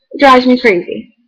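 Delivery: ordinary delivery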